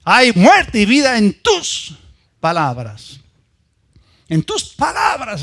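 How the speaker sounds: preaching at full force. Acted. Preaching climax.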